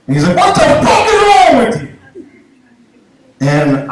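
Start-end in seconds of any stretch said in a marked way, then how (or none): 0.4-1.9 very high-pitched imitated shout; furious mock yell, pitched way up; angry dog owner. Acting out furious dog owner again.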